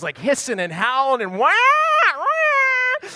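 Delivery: a cat's wail, the wah jumping very high; imitating a howling cat. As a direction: howl like the angry cat.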